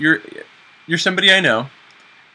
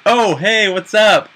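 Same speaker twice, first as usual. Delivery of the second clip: cheery greeting; 7 semitones higher, 4 dB louder, much slower than usual. Retold or imitated. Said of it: "And I'm like".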